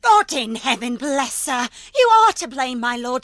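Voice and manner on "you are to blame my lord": indignant outburst. Acted. Nurse confronting the master in a loud outburst. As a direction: throw it at him in defiance. Nurse defends Juliet against Capulet.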